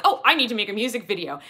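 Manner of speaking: mock-urgent quote; imitating YouTubers. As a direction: mimic an overeager YouTuber in a hurry. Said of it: "they're all like".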